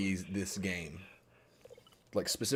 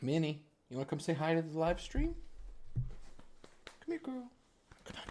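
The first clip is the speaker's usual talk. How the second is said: talking to the dog; 9 semitones higher, 4 dB quieter than usual. Real, not acted. Calling the dog to come.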